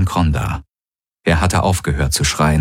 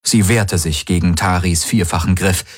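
usual speaking voice

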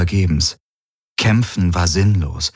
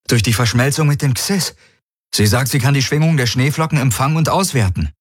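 higher, quick and eager explaining; eager young pilot; 6 semitones higher, faster than usual. Acted. Garland explains to Kirai how the Helix helps, eager and quick. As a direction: rush the explanation, lifted with hope.

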